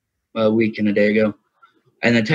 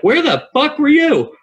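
loud, much higher, slow furious yell; mad; 5 dB louder than usual. Retold or imitated. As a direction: blast it at the friend who vanished. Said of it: "Like, mad".

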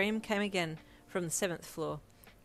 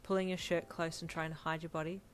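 plain reading voice

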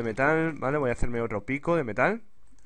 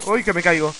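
loud, higher startled cry; alarmed yelp; 4 semitones higher, 8 dB louder, faster than usual. Real, not acted. Player nearly falling in the game, alarmed yelp. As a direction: yelp as if losing your footing.

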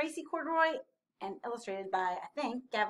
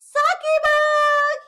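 very high, drawn-out call; shrieked storybook call; loud cry; 17 dB louder, much slower than usual. A narrator's reading. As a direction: shout for a lost toy. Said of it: "he cried".

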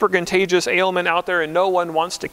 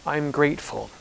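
quiet thanks; 3 semitones lower, 6 dB quieter than usual. Retold or imitated. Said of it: "he turned back to say".

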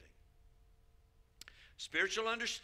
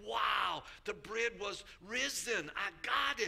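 excited; 6 semitones higher, slower than usual. Retold or imitated. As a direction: burst out like a thrilled pastor. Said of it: "he was all excited".

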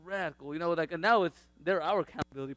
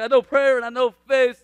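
eager declaration; 7 semitones higher, 15 dB louder than usual. Retold or imitated. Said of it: "I was like".

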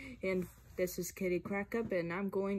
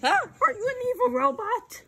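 alarmed; 8 dB louder than usual. Acted. Kid asks voiced robot.

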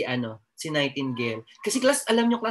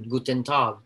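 German greeting; 4 semitones lower, slower than usual. Shown as a demonstration. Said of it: "Ano ba alam kong German?".

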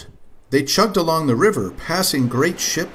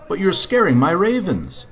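calling out; 6 semitones higher than usual. Acted. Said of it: "called a man".

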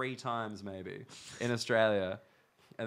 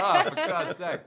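exasperated; 7 dB louder, slower than usual. Retold or imitated. Exasperated retelling with laughter.

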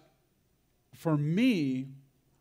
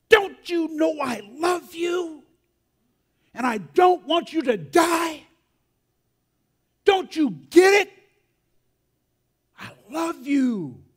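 impassioned crying shout; 14 semitones higher, 12 dB louder than usual. Retold or imitated. Aunt shakes grandson, shouting her love, crying. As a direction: plead at full volume, imitating a tearful aunt. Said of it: "And she is crying".

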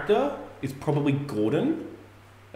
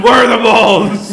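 high, loud slow demand; loud laughing shout; 7 semitones higher, 12 dB louder, slower than usual. Pretend. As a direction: roar it while laughing. Mock interrogation.

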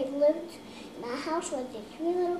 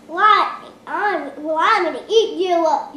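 loud troll threat; 3 semitones higher, 12 dB louder than usual. Acted.

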